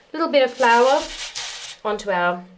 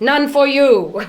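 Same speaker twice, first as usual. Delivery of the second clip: teasing laugh; 4 semitones higher, 6 dB louder, slower than usual. Real, not acted. Teasing her husband.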